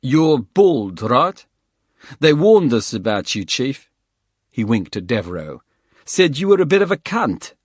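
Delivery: cocky, loud South African voice; South African accent. Acted. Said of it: "distinctive South African accent".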